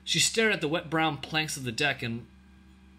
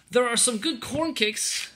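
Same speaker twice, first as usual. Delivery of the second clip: put-on slow, high girlish voice; young girl; 11 semitones higher, 3 dB louder, slower than usual. A narrator's reading. Ellen offering the soldier other food, high and slow. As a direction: offer an alternative in a child's voice.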